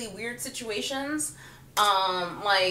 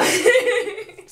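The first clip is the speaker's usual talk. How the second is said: high, loud burst of laughter; loud giggle; 12 semitones higher, 8 dB louder than usual. Real, not acted. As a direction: laugh at your own story. Laughs at her own story.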